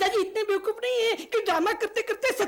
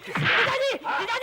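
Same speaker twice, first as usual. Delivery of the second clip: raised, urgent call; calling for help; 3 semitones higher, 4 dB louder, slower than usual. Acted. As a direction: call out to brother-in-law over the scuffle. Calls out during a brawl.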